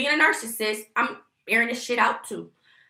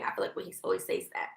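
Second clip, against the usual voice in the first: whispering; 9 dB quieter, faster than usual. Real, not acted. Quick aside to viewers.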